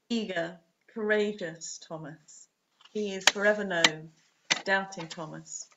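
ordinary delivery

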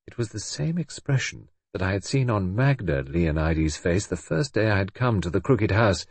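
plain reading voice

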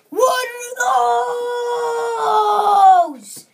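high, loud shrieking cry; meme shout. Pretend. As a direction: scream it like the meme. Meme shout on seeing shirts.

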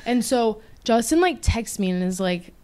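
ordinary delivery

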